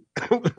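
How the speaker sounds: sudden harsh cough; coughing fit. Real, not acted. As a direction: cough out a swallowed fly. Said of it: "I think I just inhaled a fly".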